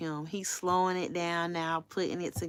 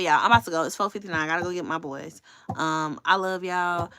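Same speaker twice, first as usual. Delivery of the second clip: normal talking voice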